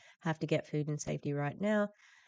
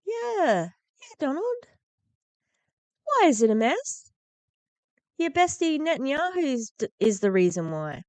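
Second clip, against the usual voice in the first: much higher, louder mocking snap at Donald; sarcastic retort; 10 semitones higher, 7 dB louder than usual. Real, not acted. Commentator answering Trump's clip, angry sarcasm. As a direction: fire back at him with scorn.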